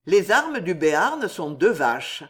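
ordinary delivery, nothing marked

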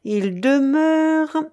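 high, very drawn-out, suspended words; loud, lingering start of reading aloud; reading out slowly. A narrator's reading. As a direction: stretch it out while finding your place on the note. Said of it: "il mit ses lunettes".

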